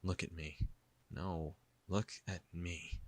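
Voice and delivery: soft, slowing command; quiet insistence. A narrator's reading. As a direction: insist gently but firmly, drawing it out. Jisoo quietly insists on eye contact.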